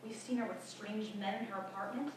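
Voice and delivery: low, hushed scandalised gossip; disapproving neighbours' murmur; gossiping neighbours. Acted. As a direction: pass judgment under your breath. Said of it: "they would say".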